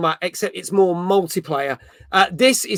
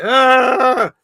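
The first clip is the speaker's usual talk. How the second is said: excited squeal; 7 semitones higher, 5 dB louder than usual. Retold or imitated. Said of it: "and we're like".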